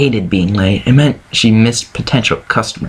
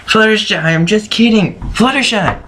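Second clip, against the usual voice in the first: calling out; 10 semitones higher than usual. Acted. Rainbow calls to hiding Fluttershy.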